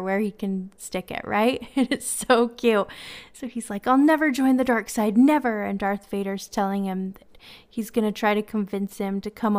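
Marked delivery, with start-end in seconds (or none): 3.9-5.6 passionate defiance. Retold or imitated. Little nephew standing up to Darth Vader, passionate defiant shout. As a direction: plant your feet and shout it at the villain. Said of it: "he's just so passionately".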